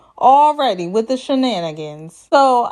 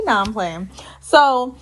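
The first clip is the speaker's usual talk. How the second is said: ordinary talk, nothing marked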